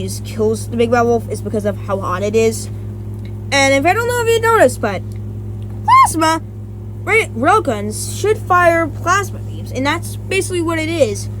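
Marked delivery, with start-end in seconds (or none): none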